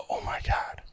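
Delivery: whispered realization, acted out; stunned whisper. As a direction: whisper it as the truth hits you. Retold moment of breaking down, realization.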